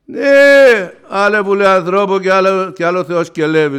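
high, loud insistence; forceful reply, high and loud; emphatic retort. Acted. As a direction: drive the point home.

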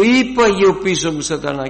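loud, high cry at Satan; shouted rebuke. Retold or imitated. Preacher shows how he shouts at the enemy. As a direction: shout the command out. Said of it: "φωνάζω και λέω".

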